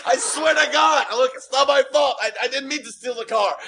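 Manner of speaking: mock terrified plea at the border; imitated panic. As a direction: beg him not to shoot. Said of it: "Là, je panique".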